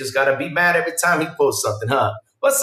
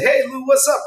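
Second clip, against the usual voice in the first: viewer greeting; 9 semitones higher than usual. Retold or imitated. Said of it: "they come by and say".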